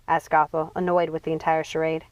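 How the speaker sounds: ordinary delivery, nothing marked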